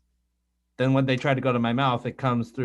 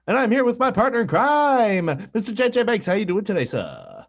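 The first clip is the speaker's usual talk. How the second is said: show announcer; 8 semitones higher, 4 dB louder than usual. Acted. Host introducing partner in crime, grand announcer. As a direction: announce it grandly, then sink on "sir".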